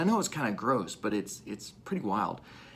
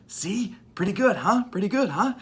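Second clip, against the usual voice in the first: much higher, teasing and pleased; playful proud Jesus; 10 semitones higher, 6 dB louder than usual. Retold or imitated. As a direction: show off the good news with a grin.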